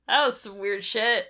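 ordinary talk

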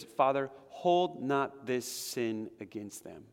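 slow, solemn reading of the prayer; quoted prayer. Retold or imitated. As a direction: lay each word down slowly. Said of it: "Stephen says".

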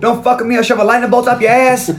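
high-pitched, hammed-up warning; angry threat. Zeus threatening John over his name, angry threat.

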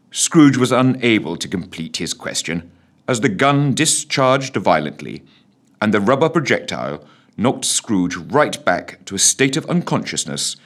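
usual speaking voice

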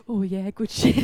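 lightly voiced stoner; mock stoned. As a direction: drawl it like a stoner. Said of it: "I was like".

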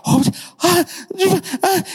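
short gasping cries, spaced apart; imitated freezing groans. Hunter barely walking out of the river, mock freezing gasps. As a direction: gasp stiffly as if frozen through. Hardly able to walk, freezing.

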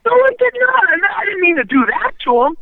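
high-pitched, protesting innocence; acting out an insistent defence; retold pleading denial. Acted denial of the referee's accusation.

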